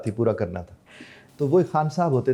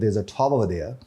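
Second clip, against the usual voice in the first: slow, "There's a tower there" run together, r dropped; British linked speech; much slower than usual. Shown as a demonstration. The teacher shows how a Brit says it, r dropped. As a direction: mimic a Brit eliding the r in "tower". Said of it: "अगर मैं आपसे कहता हूँ".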